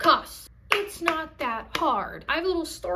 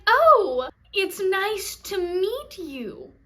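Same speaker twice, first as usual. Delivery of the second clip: high, drawn-out gushing introduction; loud "oh", then syrupy slow greeting; over-happy greeter; 5 semitones higher, 5 dB louder, much slower than usual. Retold or imitated. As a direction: imitate a delighted greeter, slow and cooing. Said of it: "she was like".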